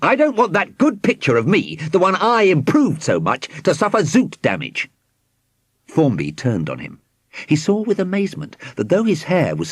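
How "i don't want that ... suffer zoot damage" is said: high, fussy protest; indignant shout. A narrator's reading. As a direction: protest as a proud artist. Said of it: "Squidgy Moot shouted".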